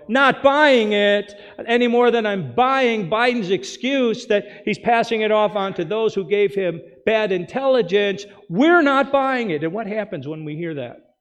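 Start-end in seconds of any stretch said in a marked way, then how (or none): 0.1-9.6 defiant crowd retort. Retold or imitated. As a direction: hammer home that nobody is fooled. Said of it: "too smart enough to go".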